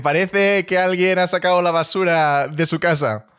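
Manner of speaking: lightly mocking, slow retelling of the joke; slow quoted joke. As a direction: spells the joke out slowly for the viewers. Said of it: "Le dicen".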